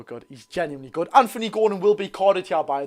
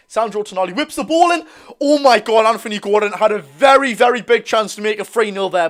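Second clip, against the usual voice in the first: loud, higher-pitched play-by-play; excited match call; 4 semitones higher, 9 dB louder than usual. Real, not acted. Streamer calling Gordon's big chance for viewers, excited. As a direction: burst out as the big chance unfolds.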